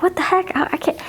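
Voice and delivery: mock exasperation. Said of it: "other people are probably like".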